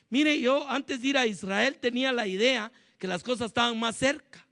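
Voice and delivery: usual speaking voice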